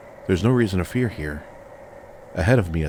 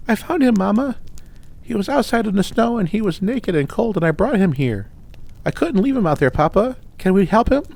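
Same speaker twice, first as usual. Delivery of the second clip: put-on high, louder childlike pleading; excited little girl; 4 dB louder than usual. A narrator's reading. Rin pleads with Mama and Papa, excited high childlike voice. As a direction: rush it out like an eager child begging her parents. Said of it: "Rin excitedly tells her parents".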